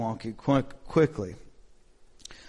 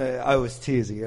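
ordinary talk

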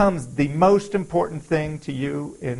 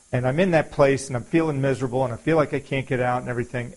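normal talking voice

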